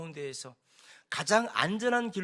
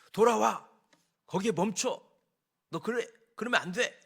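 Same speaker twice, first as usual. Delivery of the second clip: mildly raised, higher-pitched ordering voice; mock shouted command; 4 semitones higher, 3 dB louder than usual. Someone yells at freed slaves to turn back, mock shouted command. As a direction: lightly act out someone yelling orders. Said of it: "누군가가 큰 소리를 치면".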